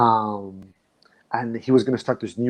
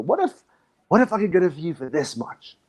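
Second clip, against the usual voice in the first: retold coaxing offer, much higher; sly sales pitch; 5 semitones higher than usual. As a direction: tempt him playfully, voice lifted high. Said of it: "I go".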